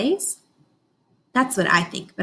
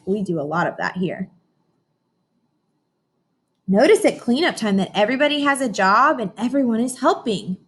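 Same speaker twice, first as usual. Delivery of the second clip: ordinary delivery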